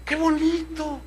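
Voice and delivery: a light imitation of her swooning; mock-delighted coworker; admiring woman. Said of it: "Entonces ella dice".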